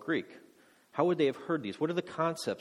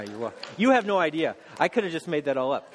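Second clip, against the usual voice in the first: ordinary talk, nothing marked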